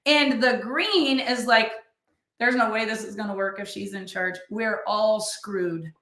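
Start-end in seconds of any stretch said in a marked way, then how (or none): 2.4-5.9 mock the pessimist giving up; gloomy doom. Gloomy green member quoted.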